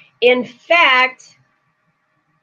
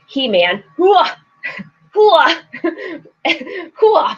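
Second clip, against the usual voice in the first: hammed-up strongman grunts, higher; mock He-Man grunts; 5 semitones higher, slower than usual. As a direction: grunt like He-Man lifting bags. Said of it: "So I'm like".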